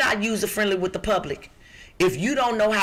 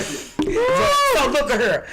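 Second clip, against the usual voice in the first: slow, stretched Texas drawl, higher; 7 semitones higher, much slower than usual. Shown as a demonstration. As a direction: drag out the words in a Texas drawl. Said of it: "I've been in Texas too long".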